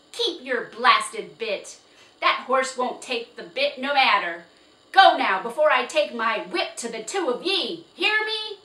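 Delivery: loud angry threats; furious shouting. Acted. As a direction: threaten them with the whip. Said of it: "shouted Mister Nye".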